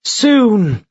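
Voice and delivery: a short reassuring promise; curt agreement. Acted. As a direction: Mara answering the eagle. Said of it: "Mara agreed".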